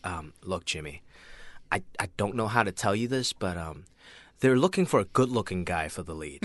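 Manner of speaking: low, hushed agent breaking bad news. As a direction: let the client down softly, hesitantly. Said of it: "you just broke into your agent voice".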